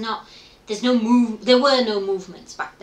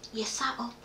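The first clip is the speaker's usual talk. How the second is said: whispered and softer, the husband at night; imitating a whisper; 2 semitones higher, 9 dB quieter than usual. Husband waking her in the night, whispered. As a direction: breathe it out like a worried husband.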